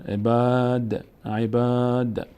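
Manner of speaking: عباد said slowly, the aa held long; Quranic recitation demo. Shown as a demonstration. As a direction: recite عباد with a lengthened alef. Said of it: "میچسبه به دال".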